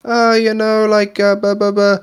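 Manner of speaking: high Northern Irish drone, all on one note; mock Northern Irish monotone, pitched high. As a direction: imitate a Belfast accent on one unchanging pitch. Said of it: "she says everything in, like, the exact same, like, level of, uh, tone".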